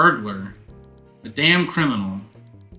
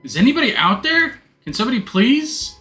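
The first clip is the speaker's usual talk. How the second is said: calling for help; 9 semitones higher, 4 dB louder than usual. Acted. Trapped in dark, calling for help.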